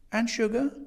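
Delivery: high-pitched, lilting offer; interrupting hostess. A narrator's reading. Landlady serving tea over guest's recollection, high questioning. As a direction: ask sweetly, pitched right up.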